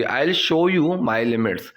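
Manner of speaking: very slow, careful teacher reading; slow model sentence. Shown as a demonstration. Teacher to students, slow clear model sentence. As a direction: lay out each word for learners. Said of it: "teacher models phrase".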